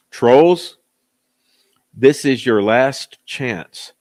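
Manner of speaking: loud, slow, stern warning. Real, not acted. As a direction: lay down a final warning.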